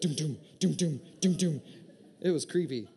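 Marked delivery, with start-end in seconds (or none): 0.0-1.6 throbbing vein noise. Retold or imitated. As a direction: mimic a vein throbbing in a doomy beat. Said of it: "it'd be like".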